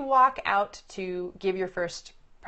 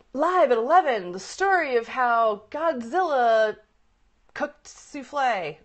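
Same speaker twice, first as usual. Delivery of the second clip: loud, stretched-out announcer hype; news tease; 4 semitones higher, 6 dB louder than usual. Acted. Coach mimicking a reporter teasing a story, announcer hype. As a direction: draw out the headline like a late-news promo. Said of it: "reporters give a tease to a story".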